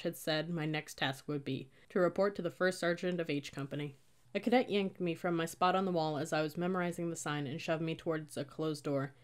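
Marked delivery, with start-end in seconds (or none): none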